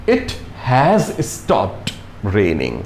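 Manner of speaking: very slow, word by word; slow example read. Shown as a demonstration. Teacher showing the perfect tense example, very slow. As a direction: spell the sentence out slowly for learners. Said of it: "देखिएगा".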